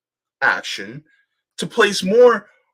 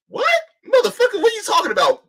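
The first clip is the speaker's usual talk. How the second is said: pitched way up in disbelief; what are you talking about, outraged; real disbelief at a bad analogy; incredulous outburst; 3 dB louder, faster than usual. Real, not acted. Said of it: "incredulous outburst".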